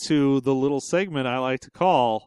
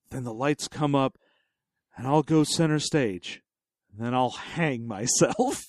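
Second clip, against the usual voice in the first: deadpan quiet plan; 4 semitones lower, 6 dB quieter than usual. Retold or imitated. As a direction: lay out the grim plan calmly. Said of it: "and he's like".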